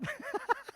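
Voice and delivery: risa breve y aguda de apuro. Real, not acted. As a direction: se le escapa una risita alta por el despiste. Se ríe tras confundir nombres. (risita aguda de vergüenza)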